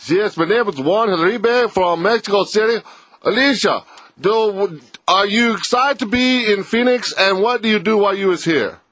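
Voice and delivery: high, hammed-up Mexican reporter accent; mock Mexico City reporter questioning a singer; mock foreign reporter. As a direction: play a foreign reporter asking eagerly. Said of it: "The questions would come at these people".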